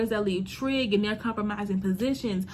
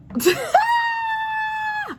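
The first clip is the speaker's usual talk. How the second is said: high shrieking burst of laughter; real howl of laughter, high and loud; laughing out loud, pitched way up; big laugh; 3 dB louder than usual. Real, not acted. Cracking up at her own joke.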